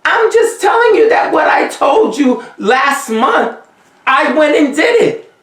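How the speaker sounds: high, forceful denial of gloating; heated insistence. Real, not acted. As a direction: pitch jumps high, near shouting. Heated denial, shout heard.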